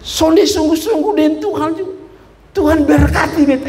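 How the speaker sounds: high-pitched mock boasting; cocky churchgoer. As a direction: imitate someone protesting their own blessing. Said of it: "ada orang bilang begini".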